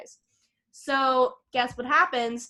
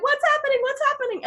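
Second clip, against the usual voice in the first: very high, urgent questioning; mock alarm; 14 semitones higher, 4 dB louder, faster than usual. Retelling a villager running up to the commotion, high mock alarm. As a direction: rush in flustered, pitched way up.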